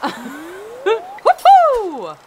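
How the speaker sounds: long, loud rising woohoo; celebratory whoop. Real, not acted. Cheers while fishing.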